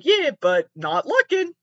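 high, loud, drawn-out mock answer; playful reply. Contestant answering whether they are single, playful high drawl. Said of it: "I'd be like".

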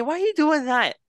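very high, mock-pleading question; retold whiny protest. Man retelling his plea as she leaves, high mock whine. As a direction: squeak it out in comic disbelief.

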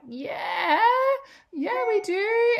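put-on high, slow, cheering squeal of small kids; excited kids. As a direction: squeal it like thrilled children. Kids cheering yes to the beach.

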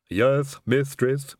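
lisping hunched servant voice; a servile Igor drawl. Acted. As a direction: play the creepy loyal servant. Igor answers his mistress.

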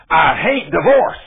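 loud, commanding divine pronouncement; stern voice of God. Retold or imitated. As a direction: declare it with authority. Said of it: "God wasn't nice and gentle with me either".

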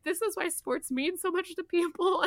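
high, tearful voice as she relives it; choked-up retelling. Retold or imitated. Retelling how she wept watching the old fan. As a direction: let the voice crack high with emotion. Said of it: "I'm like".

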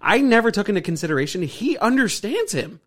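ordinary talk, nothing marked

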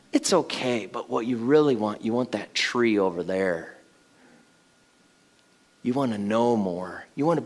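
a lightly voiced tempting serpent, low and soft. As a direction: lure them like the snake in the garden. Serpent tempting Adam and Eve.

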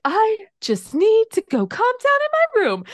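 high, loud mimic of a fuming nine-year-old; imitating upset daughter. Mother imitating her upset nine-year-old daughter, high and loud. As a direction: squeak it out like a kid storming off. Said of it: "she was like".

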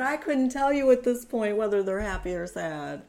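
high, loud retold wail; tearful confusion. Woman retelling her crying answer about pregnancy, overwhelmed. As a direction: blurt it out as if overwhelmed by tears.

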